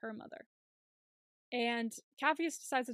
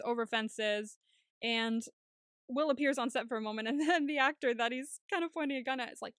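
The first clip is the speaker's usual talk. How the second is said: normal talking voice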